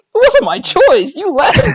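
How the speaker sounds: high, loud, slow mock protest; hammed-up kid arguing back; indignant protest. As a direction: protest the blame in a high wail. Child protesting to angry mom.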